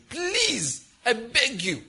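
high, drawn-out plea; pleading. Acted. A believer begging God to be spared this situation, pleading. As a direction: beg with a rising cry on please.

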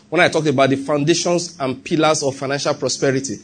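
usual speaking voice

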